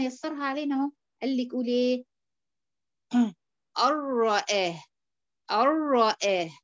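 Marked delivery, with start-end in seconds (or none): 3.9-6.5 "الرآه" with the noon merged fully into the ra, no nasal hum; idgham without ghunna. Shown as a demonstration. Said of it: "عملتي إيه أنت كده؟". (إدغام بدون غنة في "الرآه")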